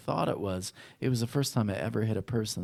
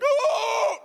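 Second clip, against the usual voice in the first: startled shout; 8 dB louder than usual. Retold or imitated. Reliving throwing the punch.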